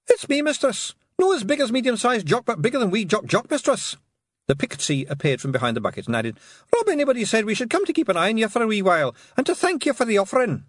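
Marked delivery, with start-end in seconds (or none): 0.1-4.0 high, bright little Scots pixie; a tiny Scots warrior reporting; Scottish pixie voice. A narrator's reading. 6.7-10.6 high, bright little Scots pixie; a tiny Scots warrior reporting; Scottish pixie voice. A narrator's reading.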